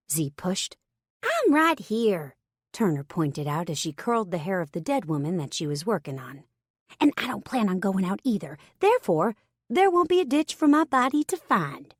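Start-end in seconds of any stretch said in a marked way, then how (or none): 1.2-2.4 Turner cutting in. A narrator's reading. Turner cuts in from across room. 7.0-11.9 Turner's voice. A narrator's reading. Turner tells Z she won't go out, higher and wry.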